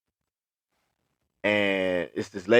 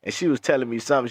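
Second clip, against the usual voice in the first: normal talking voice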